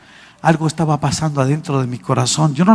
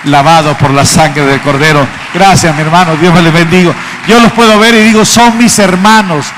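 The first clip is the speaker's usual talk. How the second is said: loud, higher preacher's cry; fiery preaching; 3 semitones higher, 9 dB louder than usual. Acted. Preacher declaring to the congregation his brothers in Christ, loud. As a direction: preach it out to the whole hall.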